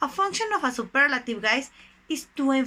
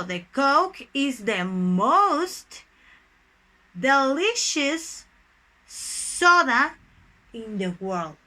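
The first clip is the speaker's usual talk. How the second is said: word by word, with pauses between; slow dictation; slower than usual. Shown as a demonstration. Teacher builds a Coke example for students, slow dictation. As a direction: draw out the example for note-takers.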